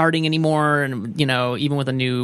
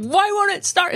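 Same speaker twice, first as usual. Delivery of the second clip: mock frustration. Acting frustration with gas mower.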